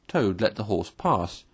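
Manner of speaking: ordinary delivery, nothing marked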